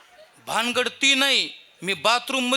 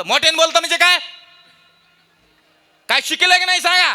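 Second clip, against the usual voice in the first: loud high scolding; angry rant; 9 semitones higher, 8 dB louder, faster than usual. Retold or imitated. Preacher scolds father-in-law over the phone, loud. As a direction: scold him for his daughter.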